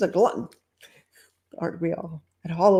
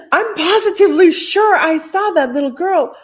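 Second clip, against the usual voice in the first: shocked lady; 10 semitones higher, 7 dB louder than usual. Acted.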